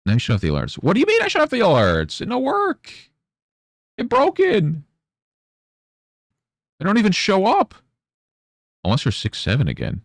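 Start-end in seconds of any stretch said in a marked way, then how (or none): 0.8-7.8 put-on much higher, babyish whine; squeaky baby-talk complaint; mock whiny child. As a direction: whine like a toddler whose toy broke. Mock whining about broken alerts.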